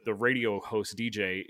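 ordinary delivery

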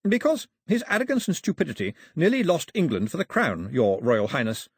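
lightly voiced official addressing royalty.

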